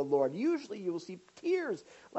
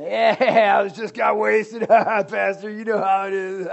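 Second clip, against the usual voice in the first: loud, hammed-up guffawing through the words; mock laughing confession; 12 dB louder than usual. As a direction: imitate someone loudly laughing off a confession. Mimics guy laughing off sin.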